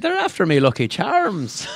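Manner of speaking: high, drawn-out leprechaun Irish; Irish accent. Shown as a demonstration. Co-host showing off his Irish accent on request, hammed-up Irish. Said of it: "Diggs has a good Irish accent".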